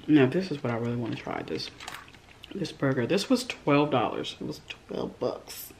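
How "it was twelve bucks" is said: slow whisper; very soft, drawn-out whispered aside; hushed aside. Real, not acted. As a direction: whisper the price like a confidence. Hushed repeat of the price.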